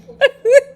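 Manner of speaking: a sharp, loud, high squeal of laughter; high laughing squeal. Real, not acted. Still reeling at the referee, high laughing squeal. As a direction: let the laugh break into a squeal.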